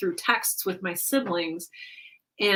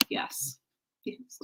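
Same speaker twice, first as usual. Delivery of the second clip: hushed whisper, fading to barely audible; breathy whisper getting quieter; whisper to the cat; 13 dB quieter than usual. Real, not acted. Cooing to the cat in her arms.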